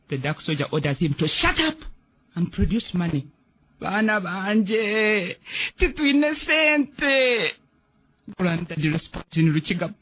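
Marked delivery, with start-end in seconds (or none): 3.8-7.5 begging, drawn-out and rising; very slow pleading cry. Acted. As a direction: plead slowly, voice climbing to a cry. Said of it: "pleading his poverty".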